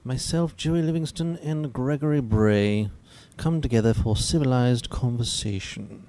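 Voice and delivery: light mock posh English host voice; a hint of a refined English accent, welcoming the viewers. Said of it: "I can't do an English accent very well".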